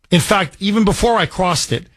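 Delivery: usual speaking voice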